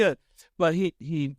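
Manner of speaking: usual speaking voice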